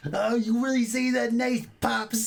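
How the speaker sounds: high, tense voice squeezed through the teeth; through gritted teeth. Retold or imitated. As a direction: imitate a jittery oddball talking through clenched teeth. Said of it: "he says every line like this".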